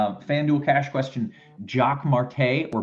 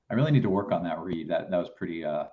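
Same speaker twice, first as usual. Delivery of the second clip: usual speaking voice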